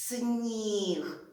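«Сніг» drawn out with a long «і»; teacher modelling «Сніг» for sound analysis; slow spelling-out. Shown as a demonstration. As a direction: say «Сніг» slowly so every sound is heard. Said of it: "Який треба промовити це слово?".

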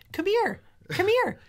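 very high parrot imitation; high bird calling come here. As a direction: squawk like the bird. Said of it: "he'd be like".

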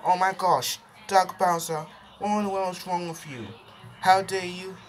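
high-voiced indignant scolding; high sister voice. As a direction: scold him as an outraged little sister. Angry sister voice scolds Bowser.

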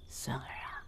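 secret whisper in his ear. Acted. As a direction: lean close and whisper the plan. Grandmother whispers to grandson.

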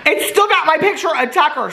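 high, loud, near-crying joy; delighted squeal. Real, not acted. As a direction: gush with relief. Relieved picture survived.

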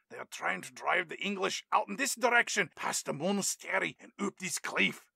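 Scottish professor addressing his class. Retold or imitated. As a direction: lecture in a put-on Scottish accent. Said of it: "He's doing this lecture".

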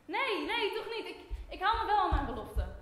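a desperate loud reversal; urgent loud plea. Acted. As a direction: call him back in a panic. Panicked reversal calling him back.

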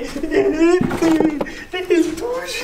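high, giddy squeal with laughter; squealing with excitement. Real, not acted. Unboxing the long-awaited device.

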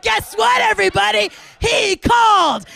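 loud, high mock gloating; triumphant announcement. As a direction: crow it to the whole crowd. Acts out triumphant announcement to friends.

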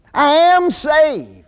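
"I am saved" said very slowly, high, leaning on "am"; triumphant slow stress on "am"; emphatic declaration. Acted. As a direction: proclaim "I am saved" with "am" lifted high and stretched. Preacher's climax of his point.

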